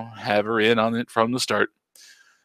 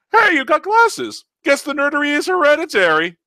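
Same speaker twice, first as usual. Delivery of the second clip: high teasing voice; 7 dB louder than usual. Acted. Voices Taco.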